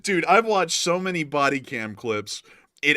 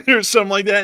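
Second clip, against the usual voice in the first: usual speaking voice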